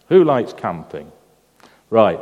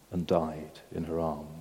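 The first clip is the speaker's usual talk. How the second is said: hushed grief; 8 semitones lower, 13 dB quieter than usual. A narrator's reading. Preacher telling of the baby's death, hushed and grieving. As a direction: drop much lower and much softer, barely there. Mother's baby dying in arms.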